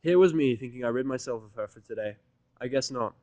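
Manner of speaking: plain reading voice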